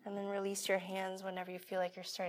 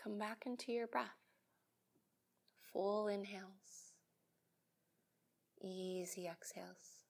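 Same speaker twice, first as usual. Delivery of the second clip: soft calm guidance; 8 dB quieter than usual. Acted. Slow breath guidance.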